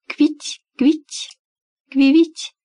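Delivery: high chirping tweets; chirping bird person. Acted. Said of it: "прощебетала птичка".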